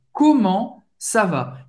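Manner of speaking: "Comment ça va?" devagar, sílaba por sílaba; slow model. Shown as a demonstration. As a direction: repita "Comment ça va?" bem lento. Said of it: "A segunda é comment ça va". ("Comment ça va?" bem devagar)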